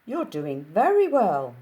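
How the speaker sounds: loud lilting Capitol voice; lightly done Capitol accent. Acted. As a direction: sing it out like a Capitol stylist. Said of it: "the ends of their sentences go up".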